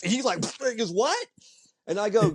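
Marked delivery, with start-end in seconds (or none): 0.9-1.3 high-pitched, incredulous yelp; spit-take shock. Retold or imitated. Friend hearing of the autograph at dinner, spit-take shock. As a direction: blurt it in comic disbelief. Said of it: "it's like the spit take".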